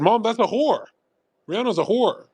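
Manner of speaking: mock-indignant objection; retold indignant protest. Retold childhood protest to mom.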